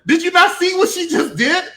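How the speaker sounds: ordinary delivery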